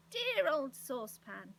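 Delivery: loud, drawn-out greeting rising high; delighted shouts. A narrator's reading. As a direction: greet an old friend at full voice. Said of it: "heard his friend's shouts".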